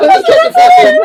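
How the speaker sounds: laughing shout. Real, not acted.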